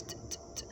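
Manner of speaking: unvoiced t t t counting rests; soft ticking t sounds marking the gap; whispered beat taps. Shown as a demonstration. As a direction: tap out the empty syllables in a whisper. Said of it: "three syllables there too of space".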